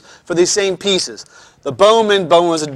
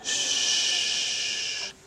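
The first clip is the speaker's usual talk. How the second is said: long, soft shush; 14 dB quieter than usual. Real, not acted. Teacher quieting the class, long shush. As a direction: hush them with a drawn-out shh.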